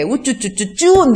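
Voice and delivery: slow, drawn-out 우쭈쭈쭈쭈; playful mock baby talk to a child; cooing baby talk. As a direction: coo it like soothing a baby. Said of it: "이렇게 말을 하지".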